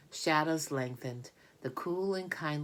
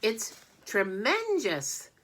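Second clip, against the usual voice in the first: put-on awed boy voice; 8 semitones higher, 5 dB louder, slower than usual. Avery marvels at the spider.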